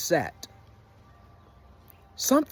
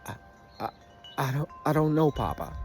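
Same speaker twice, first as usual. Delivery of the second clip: tearful stammer; 2 semitones lower, 5 dB quieter, faster than usual. Retold or imitated. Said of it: "Stop crying".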